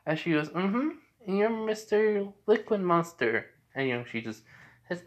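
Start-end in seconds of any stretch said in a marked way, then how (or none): 0.5-3.4 put-on high little-girl voice, unhurried; Eri. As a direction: answer innocently in a high child voice. Little girl answers the monster.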